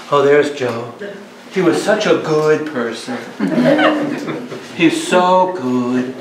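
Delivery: syrupy mock eulogy, last words drawn out; mock mourner. A priest mimicking mourners by the coffin, gushing mock praise. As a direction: imitate a gushing mourner at the casket.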